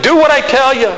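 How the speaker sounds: "Do what I tell you" high and loud, scolding; hammed-up exasperated God giving orders; nagging God. God ordering the preacher to obey, high and loud nagging. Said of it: "Do you think God speaks like that?".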